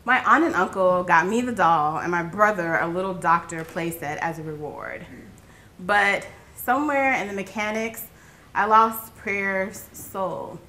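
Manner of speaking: ordinary delivery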